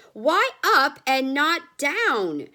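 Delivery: loud, high and slow; loud demand. A narrator's reading. Family demanding why the elevator goes up, loud. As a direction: insist on an answer.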